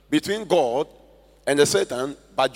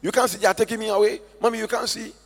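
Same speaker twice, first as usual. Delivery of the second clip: put-on higher, pleading child's voice; dying girl pleading; 6 semitones higher, faster than usual. Dying girl pleads with her mother in a higher voice. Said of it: "And said".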